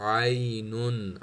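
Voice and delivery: slow आइनुन with the ain from the middle throat. Shown as a demonstration. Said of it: "middle throat से आइन की आवाज़ है".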